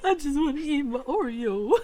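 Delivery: Scar impression. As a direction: mimic a sly cartoon villain. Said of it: "sound like something Scar would say".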